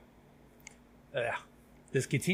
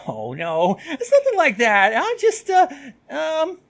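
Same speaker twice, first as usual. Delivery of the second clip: loud, very high-pitched stammer; flustered denial; 13 semitones higher, 9 dB louder than usual. Acted. Student denying she avoids her friend, flustered. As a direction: blurt a panicky denial, then falter.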